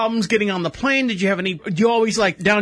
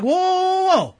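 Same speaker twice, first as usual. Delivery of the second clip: high, drawn-out whoa; retold startled backpedaling; taken-aback protest; 11 semitones higher than usual. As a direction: put up a high protest to halt the attack. Retelling his protest to the commenter.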